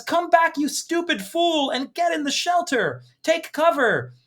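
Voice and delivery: high, strained mock yell; losing his mind. Husband ordering his wife into the shelter, frantic mock yell. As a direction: act out a frantic, rude husband yelling. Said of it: "the gentleman is kinda losing his mind".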